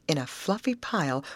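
usual speaking voice

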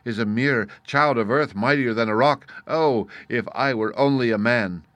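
loud furious outburst; wrathful cry. A narrator's reading. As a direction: hurl it out in wrath. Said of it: "he cried in his wrath".

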